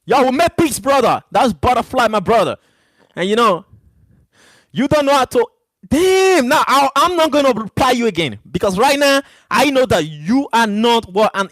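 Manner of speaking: high, loud and worked up at a chat troll; heated rant. Real, not acted. Streamer ranting at a troll in chat, high and loud. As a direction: let it boil over, voice climbing.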